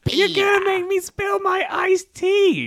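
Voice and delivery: high, loud whiny cartoon wife; Lois Griffin. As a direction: yell at the husband in a high-pitched character voice. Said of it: "then Lois is like".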